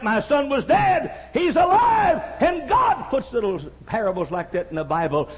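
high, loud pulpit cry; preaching shout. Acted. Preacher crying out God's call to the congregation, loud. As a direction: lift the voice to a preaching peak.

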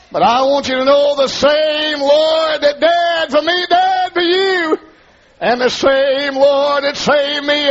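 high chanted preaching, near a shout; sing-shout the gospel line at full force; preaching whoop. Real, not acted.

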